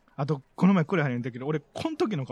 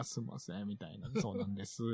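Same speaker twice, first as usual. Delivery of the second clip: sheepish mumble; 9 semitones lower, 14 dB quieter than usual. Retold or imitated. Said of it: "「あ、すんません」 みたいな".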